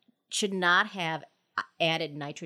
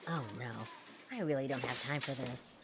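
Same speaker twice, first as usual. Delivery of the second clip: softer and lower, to herself; muttered aside; 5 semitones lower, 6 dB quieter than usual. Acted. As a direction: grumble under the breath. Sneaking away, muttered.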